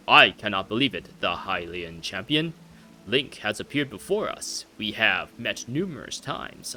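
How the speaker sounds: grand, swooping royal delivery; a king marvelling at an old acquaintance; nostalgic old king. Acted. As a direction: reminisce regally, slowing at the end. Voices the king again.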